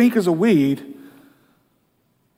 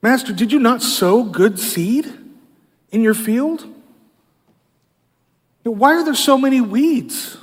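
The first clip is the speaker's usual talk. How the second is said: higher, slow, accusing plea to the master; accusing question; 5 semitones higher than usual. Acted. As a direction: press the master as if blaming him. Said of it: "it's more of an accusation".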